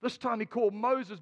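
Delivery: ordinary delivery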